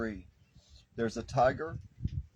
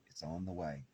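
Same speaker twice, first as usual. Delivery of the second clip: softer and lower, almost to herself; a quiet, lowered repeat of the promise; hushed echo; 5 semitones lower, 9 dB quieter than usual. Real, not acted. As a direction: let it fall away gently. Repeats promise, loud then hushed.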